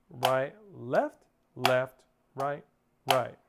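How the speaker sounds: evenly spaced words on the beat; measured, metronomic drum count; rhythmic sticking count. Shown as a demonstration. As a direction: call out each hand in steady time. Calling sticking in time with playing.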